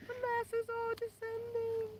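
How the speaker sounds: mock dramatic announcer. Dramatic mock of crowds arriving.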